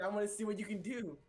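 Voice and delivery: bold heroic challenge; earnest determined anime hero. Acted. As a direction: deliver it like an anime protagonist. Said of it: "Now he sounds like an anime protagonist".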